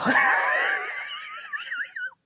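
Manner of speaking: high shrieking laugh. Real, not acted. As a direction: cackle at yourself. Laughs at sad life.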